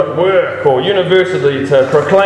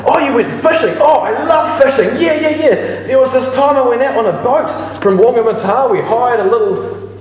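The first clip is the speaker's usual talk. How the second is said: higher, breezy mate bragging about fishing; chatty friend voice; 6 semitones higher than usual. Retold or imitated. Churchgoer voicing a chatty friend telling his fishing story, higher and breezy. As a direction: play the cheerful friend raving about his seasick boat trip.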